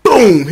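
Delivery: loud vocal boom; sudden booming impact noise; imitated crash. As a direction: punch out a falling-object sound. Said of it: "all of a sudden you hear".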